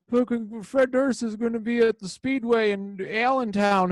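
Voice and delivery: high, excited mock local bro. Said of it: "doing the whole, like".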